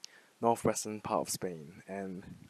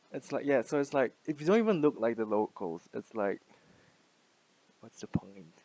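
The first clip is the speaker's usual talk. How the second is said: usual speaking voice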